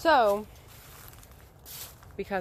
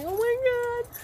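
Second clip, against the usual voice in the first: shocked; slower than usual. Retold or imitated. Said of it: "And I was just like".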